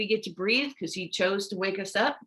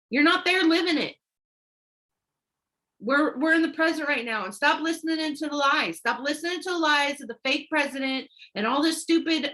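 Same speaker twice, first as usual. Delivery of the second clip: raised, insistent preacher's voice, higher; urgent exhortation, pitched up; preaching at full force; 5 semitones higher than usual. Acted. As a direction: drive it home like a pulpit sermon. Fired-up preaching at viewers.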